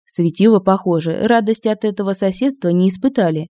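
plain reading voice

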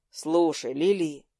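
low, resigned address; lightly voiced boy with understated sigh. A narrator's reading. Lucas starts an apology to Лили, resigned. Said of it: "вздохнул он".

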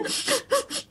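soft, choked little sniffles; demonstrated stifled sobbing. Shown as a demonstration. Mother lying with eyes shut, imitated stifled crying. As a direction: sniffle softly in acted aggrieved sobs. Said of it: "tui khóc vậy đó ha".

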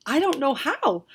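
put-on frightened girl crying out to the magicians; cried out. A narrator's reading. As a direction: cry it out in panic. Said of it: "the girl cried".

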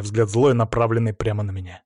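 ordinary delivery, nothing marked

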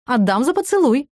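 high, playful tease; teasing. A narrator's reading. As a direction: tease her coyly. Sergey teasing.

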